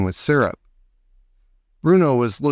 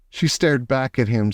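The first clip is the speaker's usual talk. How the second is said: ordinary delivery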